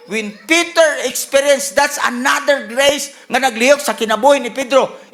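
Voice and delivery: emphatic, building sermon delivery; forceful preaching. Real, not acted. Preacher tells of Jesus restoring Peter, preaching forcefully. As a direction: press the point with rising force.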